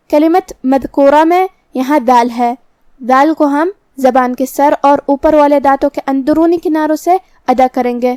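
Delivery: usual speaking voice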